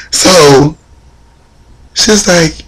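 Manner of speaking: usual speaking voice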